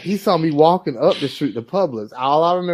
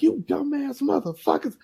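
mom cursing; 13 semitones higher, 4 dB quieter than usual. Retold or imitated. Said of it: "And she's like".

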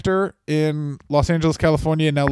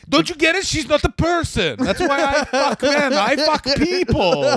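hammed-up high Italian accent, slow; a mock Italian accent with a laughing push; 10 semitones higher than usual. Cohost mocking wrestling execs in a hammed-up Italian accent. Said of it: "That's a really good Italian accent".

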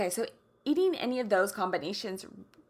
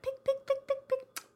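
high-pitched little pecks; bird pecking noises. Acted. Showing how I eat like a bird, high pecking sounds. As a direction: pick like a tiny bird, high and crisp.

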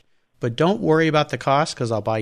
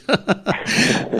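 high breathy laugh; real delighted laugh; burst of laughter; 9 semitones higher than usual. Real, not acted. Host delighted by auction demo.

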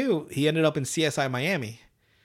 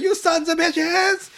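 put-on very high, slow furious kid voice; Cartman cursing; 13 semitones higher, 4 dB louder, slower than usual. Cartman cursing the aliens as they go, high shriek. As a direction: scream the curse like a cartoon brat.

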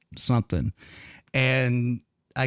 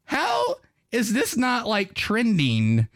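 incredulous; 10 semitones higher, slower than usual. Retold or imitated. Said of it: "And I'm like".

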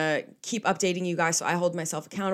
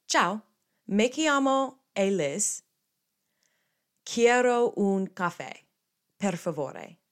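slow, raised, stretched-out phrases; careful language practice; 3 semitones higher, slower than usual. Shown as a demonstration. Redoing her Italian for listeners, slow and careful. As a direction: recite each foreign word deliberately.

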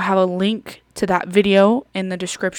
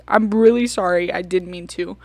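usual speaking voice

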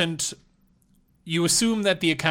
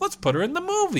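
mock producer voice; faster than usual. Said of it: "they're like".

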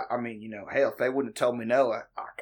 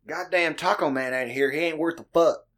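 put-on country work accent; 5 semitones higher than usual. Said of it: "I do not talk like that".

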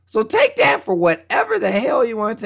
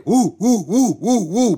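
cheering woo; 3 semitones higher than usual. Pretend. Cheering for Judgment Day.

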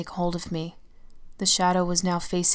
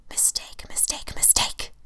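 slow, menacing ghostly whisper; threatening whisper; 4 dB quieter, slower than usual. Acted. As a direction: warn her in a cold hiss. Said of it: "the shadow threatened".

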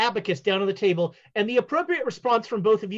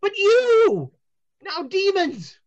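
much higher, louder; seer voice; 9 semitones higher, 5 dB louder than usual. Acted. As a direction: brush them off mystically. Fortune teller answers.

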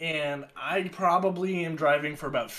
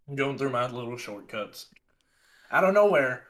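usual speaking voice

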